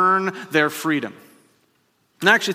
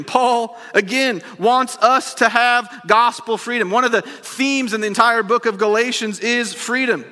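higher, louder sermon voice; full-force preaching; 6 semitones higher, 3 dB louder than usual. Real, not acted. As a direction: preach it out with fire.

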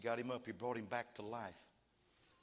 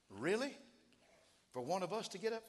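higher, incredulous rising question; acted disbelief; 9 semitones higher, 4 dB louder than usual. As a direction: question it as if you can hardly believe it. Preacher voices disbelief at resurrection.

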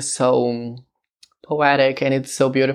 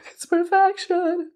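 high squeal. Real, not acted.

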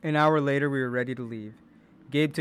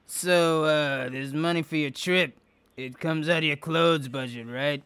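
gruff suspicious man; 4 semitones higher, 5 dB louder than usual. A narrator's reading. Gabe voiced.

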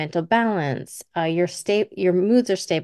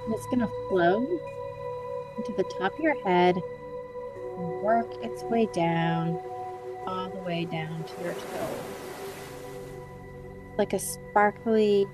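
guided meditation; 7 dB quieter than usual. Acted. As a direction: gently guide them into calm relaxation.

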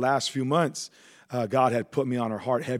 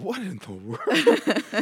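baffled; 8 semitones higher, much slower than usual. Retold or imitated. Baffled inner thought, laughing.

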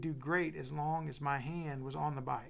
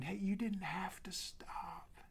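soft, slow whispered reassurance; a daddy softly reassuring his son; gentle daddy; 4 semitones higher, 4 dB quieter, slower than usual. Retold or imitated. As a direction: hush it tenderly like a dad. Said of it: "just a daddy going".

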